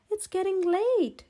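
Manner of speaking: ordinary delivery, nothing marked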